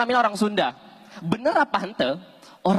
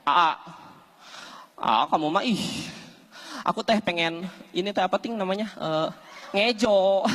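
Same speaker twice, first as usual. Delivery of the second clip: put-on girlfriend voice; 2 semitones lower, 4 dB quieter than usual. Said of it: "Awewe na ngomong".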